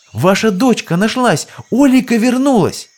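high excited shouting. Acted. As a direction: call out the good news. Teens shouting the news.

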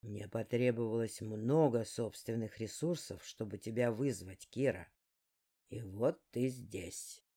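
low, slow sly villain; a deep, unhurried demon lord; dark lord voice. Acted. Dark's lines voiced low.

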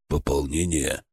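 low, hushed murmur; soft, low, near-whispered question. A narrator's reading. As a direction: ask it under your breath. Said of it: "шёпотом произнёс Харитон".

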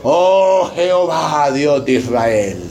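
slow, drawn-out lament sinking away; mock tearful prayer. Pastor has church imagine Israel praying, mock lament. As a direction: stretch each vowel in mock piety. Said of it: "se los imagina".

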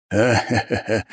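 mocking laugh. A narrator's reading. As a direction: guffaw at him. Mercenary laughing.

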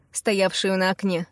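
usual speaking voice

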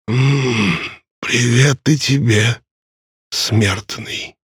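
raspy, aged rasp of a ferryman; hoarse old man. Acted. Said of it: "сипло отозвался старик".

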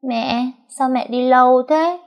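put-on high, slow little child voice; babbling toddler. A narrator's reading. Toddler asking his crying mother, high slow child voice. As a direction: lisp it out like a small boy. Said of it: "Nó nói bập bẹ".

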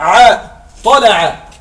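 طلع said very slowly, letter by letter; spelling the root. Shown as a demonstration. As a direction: stretch out طلع to show the root. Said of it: "الحروف الأصلية".

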